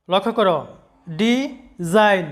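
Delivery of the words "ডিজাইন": ডিজাইন drawn out very slowly in two syllables, di - zine; slow syllable split. Shown as a demonstration. Teacher slowing down so students hear both syllables. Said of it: "শব্দটা দুইবারে উচ্চারণ করতে হয়".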